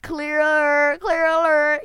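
loud, high mock echo of a slip; mocking own slip. Host teasing himself over a mistake, loud mocking. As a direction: jeer the word back, loud and high.

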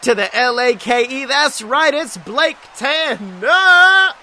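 ringmaster. Acted. Hyping the show host.

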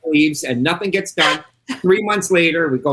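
ordinary delivery

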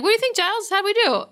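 high, eager imitation of pupils; eager mock question. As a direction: ask brightly, fishing for praise. Acts out eager slayers asking Giles.

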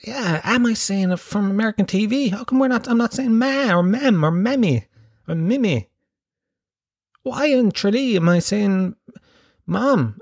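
high-pitched mock bewilderment; hammed-up puzzled protest to oneself; self-questioning. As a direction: act out doubting yourself, voice climbing high. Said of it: "questioning myself".

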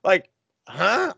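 high-pitched incredulous questioning; mock confusion. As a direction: act out baffled disbelief, voice jumping up high. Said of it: "I was so confused".

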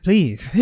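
echoed "Please" ending in th; "Please" with a put-on lisp at the end; "Please" said as pleath; lisped final S. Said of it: "doing that voice when we finish a letter with S".

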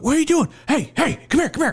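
high, urgent imitated hollering; urgent calling out. Cashier calling after a shoplifter, urgent calling out. Hears a man calling out behind him.

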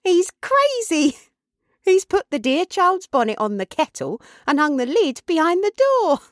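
put-on high-pitched, incredulous young woman's voice; astonished cry. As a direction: exclaim at father's muddle, climbing high. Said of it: "cried Meg".

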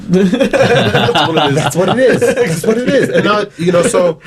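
high-pitched, laughing agreement; real laughter breaking through the words. Real, not acted. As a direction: crack up while repeating the words. Cracking up at co-guest's joke.

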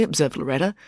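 ordinary delivery

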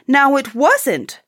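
high, loud, drawn-out exclamation; eager cry. A narrator's reading. Bob telling his family of the nephew's kindness, crying out. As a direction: cry it out slowly with lifted pitch. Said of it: "cried Bob".

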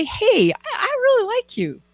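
high-pitched, sweet confession of liking; acted sweet-talk, high and eager; eager affection. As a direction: gush it shyly, pitched well up.

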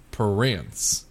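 slow Southern "Prince" stretched into two syllables; Alabama Southern accent. Shown as a demonstration. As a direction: say "Prince" as puh-RINCE, slow Alabama drawl. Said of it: "Southern's more of adding syllables".

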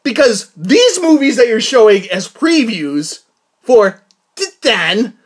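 slow build to a high, loud shout on garbage; building rant. Real, not acted. Vlogger ranting that the previews are garbage, ending in a shout. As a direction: let it swell into a yell. Ranting about bad trailers.

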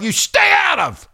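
shouted high and slow; angry shout. Real, not acted. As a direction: yell it, slow and furious.

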